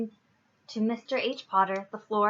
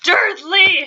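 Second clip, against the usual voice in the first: high, very loud boom; explosive angry summons; booming shout; 9 semitones higher, 14 dB louder than usual. A narrator's reading. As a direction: bellow the surname in fury. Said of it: "he boomed".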